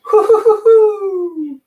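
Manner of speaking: high burst of laughter. Real, not acted. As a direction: cackle high.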